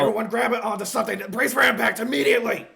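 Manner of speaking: high, loud, fast emergency yell; Monroe's scream. Acted. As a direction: scream the order at everyone. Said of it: "Monroe immediately screams".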